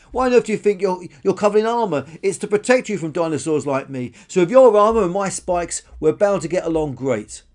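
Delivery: high, loud character voice; insistent spiky dinosaur, persuading. Acted. Spiky dinosaur persuades armoured one to befriend her, insistent. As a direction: argue the case loudly and eagerly.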